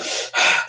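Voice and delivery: quick heavy puffs of breath; mock hyperventilation, huffing hard in and out; panting with effort, put on for a story; acted hyperventilating. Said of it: "I'm like hyperventilating".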